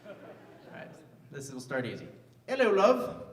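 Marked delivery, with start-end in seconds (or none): 2.5-3.2 loud, high English greeting; English accent. Shown as a demonstration. Comic quizzing the crowd on accents, English accent. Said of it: "I'm gonna do some accents".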